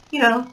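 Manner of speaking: soft breathy sigh; weary sigh. Real, not acted. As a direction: sigh it out, tired. Sigh, unvoiced.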